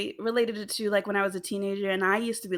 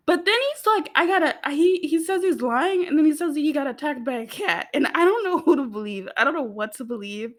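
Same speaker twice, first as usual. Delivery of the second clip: excited; 6 semitones higher, 4 dB louder than usual. Real, not acted. Flustered gushing over episode.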